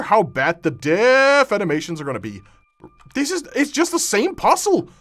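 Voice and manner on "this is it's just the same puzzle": exasperated. Real, not acted. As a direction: complain in disbelief. Exasperated outburst.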